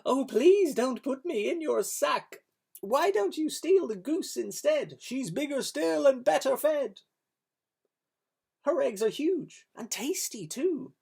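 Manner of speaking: high, pleading duck voice. Acted. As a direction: beg like a frightened little duck. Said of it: "the downy duck began to quack".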